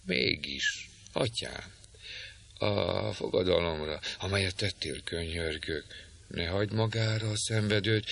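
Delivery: hushed earnest plea to a holy father; soft pleading maiden. Acted. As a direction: plead gently and humbly, barely above a breath. Rebecca keeps begging.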